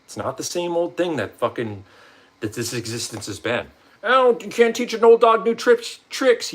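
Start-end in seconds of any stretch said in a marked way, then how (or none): 4.0-6.5 high, loud mock know-it-all; mocking naysayer. Vlogger mocking those who resist change, sneering. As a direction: sneer out the old saying.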